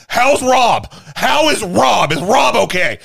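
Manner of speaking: high, loud angry shouting; furious demand. Retold or imitated. Farley angrily demanding news of Rob the next day, shouting. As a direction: bark the question in a rage.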